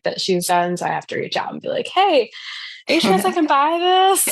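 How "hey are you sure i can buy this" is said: high, bright-eyed polite plea to an artist, raised higher; asking politely. Retold or imitated. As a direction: put on a sweet, hopeful asking voice, pitched up. Acting out a polite request to an artist.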